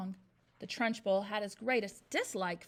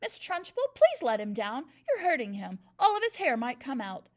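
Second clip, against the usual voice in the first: pleading cry; 3 semitones higher, 8 dB louder than usual. A narrator's reading. Teacher begging the headmistress to release him, pleading cry. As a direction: beg her to stop. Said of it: "Miss Honey cried out".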